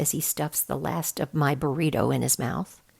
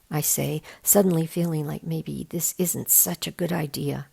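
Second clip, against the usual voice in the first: usual speaking voice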